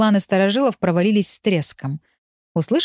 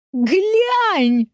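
very high, drawn-out "look!"; stifled glee; 12 semitones higher than usual. A narrator's reading. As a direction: squeal it to grandma, barely containing yourself. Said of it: "пытаясь не расхохотаться в голос". (восторженно, тоненько, сдерживая смех)

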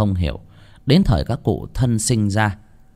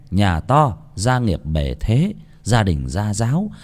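plain reading voice

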